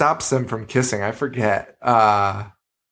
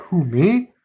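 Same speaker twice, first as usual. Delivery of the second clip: surprised nerd; 7 semitones higher than usual. Retold or imitated. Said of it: "He's like".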